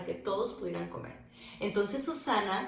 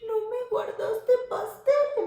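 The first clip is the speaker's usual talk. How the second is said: mock whine; 13 semitones higher, 5 dB louder, slower than usual. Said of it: "empezó a llorar".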